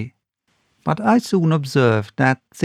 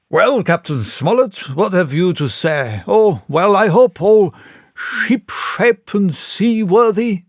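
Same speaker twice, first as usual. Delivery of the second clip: beam it out like a proud owner; jovial squire; 6 semitones higher, 3 dB louder than usual. A narrator's reading. Squire greets the captain.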